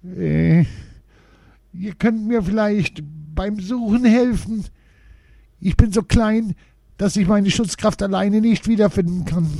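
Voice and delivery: high little dwarf voice, mildly despairing; a lost dwarf pleading for help, a touch of despair; desperate voice. A narrator's reading. Dwarf asks the children for help, mildly desperate. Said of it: "mit verzweifelter Stimme".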